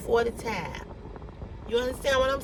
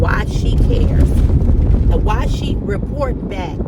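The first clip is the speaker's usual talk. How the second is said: louder, slow, drawn-out complaint; rising anger; 7 dB louder, slower than usual. Real, not acted. Venting to viewers about a nosy coworker, slow angry push. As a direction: lean hard into each word, fed up.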